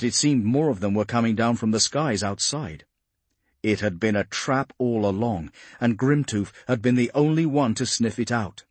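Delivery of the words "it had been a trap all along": usual speaking voice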